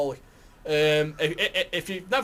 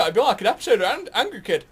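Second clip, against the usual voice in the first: normal talking voice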